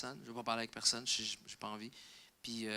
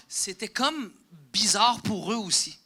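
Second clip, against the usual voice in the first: usual speaking voice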